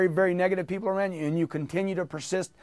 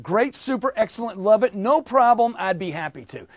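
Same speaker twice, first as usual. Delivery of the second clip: louder, higher burst of positives; energetic demo; 4 semitones higher, 5 dB louder than usual. Shown as a demonstration. Coach showing the drill at full energy. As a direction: fire them off with gusto. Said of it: "follow with me".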